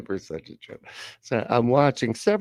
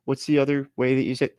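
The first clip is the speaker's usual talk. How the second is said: ordinary talk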